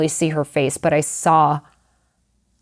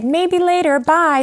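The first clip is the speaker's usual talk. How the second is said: high, breezy dismissal; a woman's high breezy brush-off to strangers; 11 semitones higher than usual. Retold or imitated. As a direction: wave them off lightly, voice up high. Mother brushing off visitors.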